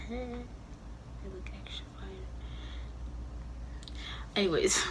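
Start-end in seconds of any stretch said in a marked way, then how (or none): 0.1-2.1 slow joking whisper; a slow whispered brag about the eyebrows. Real, not acted. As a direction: breathe it out as a giggly aside.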